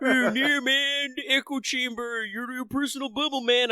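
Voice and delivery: high, whiny mock internet dude; whitey internet voice. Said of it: "trying to do my whitey internet voice".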